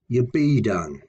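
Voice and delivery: ye BE done, weight on be; unstressed prefix. Shown as a demonstration. Teacher repeating the prefix example, stress on be done. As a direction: slip past ye, stress be done. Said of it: "are never stressed".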